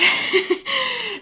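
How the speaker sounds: high-pitched amused giggle; light, high burst of laughter. Real, not acted. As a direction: giggle at your own remark. Laughs at her own questioning tone.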